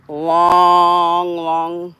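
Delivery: "long, long" with both words drawn out; "long, long" slowly elongated; stretched for length. Real, not acted. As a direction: hold each "long" to show the length. Describing length of a dragged tube.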